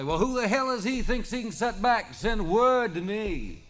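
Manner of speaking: high, loud defiant bellow, slowing at the end; defiant shout. A narrator's reading. Charlie dares the unseen boss to send word, loud defiant bellow.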